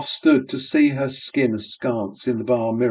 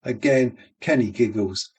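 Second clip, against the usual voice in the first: usual speaking voice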